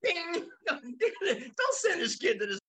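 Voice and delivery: amused mock carnival ding; mock duck-game ding, laughing. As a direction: play the fun-house bell while cracking up. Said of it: "When I go".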